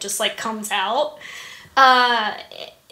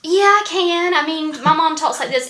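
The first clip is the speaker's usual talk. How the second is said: imitating mom's thick Southern accent; 4 semitones higher, 5 dB louder than usual. Shown as a demonstration. Daughter shows host how mom back in Georgia talks, thick Southern accent. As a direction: lay on a Southern twang like mom's. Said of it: "Can you imitate it at all?".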